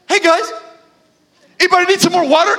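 high-pitched chirpy server imitation; overly happy waitress. Waitress greeting the table, exaggeratedly happy. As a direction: play a waitress overflowing with cheer. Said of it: "she's so happy".

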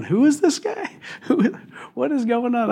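high, baffled "who is this guy?" with a laugh; amazed disbelief, voice jumping up; bewildered disciples. Retold or imitated. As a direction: play the stunned disciples, half laughing. Disciples shaken after the storm.

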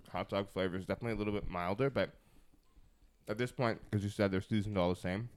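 talking with a mouth full of food; words muffled by a mouthful, mouth full. Real, not acted. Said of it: "sorry, my mouth is full".

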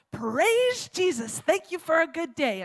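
high, stretched-out holler of praise; yelled praise. Retold or imitated. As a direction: call it out like a joyful morning prayer. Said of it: "her yelling on her front step".

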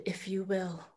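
a resentful whispered aside; whispered, grudging consent to go to England; bitter whisper. Acted.

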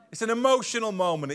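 mock defensive excuse. Shown as a demonstration. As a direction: make a lame excuse sound convincing. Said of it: "We say that".

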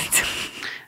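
a quiet airy chuckle; soft breathy laugh. Real, not acted. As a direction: let out a small whispered laugh. Laughs after her own quote.

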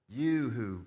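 loud, high-pitched heckling taunt; hurled insult. Retold or imitated. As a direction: call it out like a mocking passer-by. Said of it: "they were hurling insults at him".

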